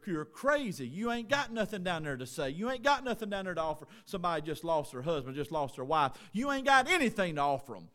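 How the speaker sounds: loud, driving preacher's rebuke; fiery preaching. Acted. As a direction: hammer it home to the congregation. Preaching at full force.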